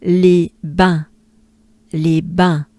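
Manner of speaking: les bains with nasal final vowel, le-bain; French les bains. Shown as a demonstration. Teacher drilling les bains aloud. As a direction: model les bains slowly, nasal ending. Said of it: "So as a whole".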